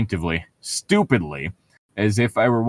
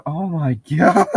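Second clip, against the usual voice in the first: laughing disbelief; 6 semitones higher than usual. Real, not acted. Laughing at the arrogance.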